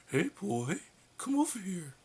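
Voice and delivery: squeaky missed attempt at Homer; failed Homer voice. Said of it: "Okay, not that".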